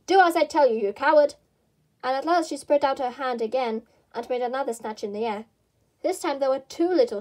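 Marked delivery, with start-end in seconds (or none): 0.1-1.3 loud scolding command; angry order. Acted. Rabbit ordering his cowardly servant, loud angry command. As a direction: snap the order at him.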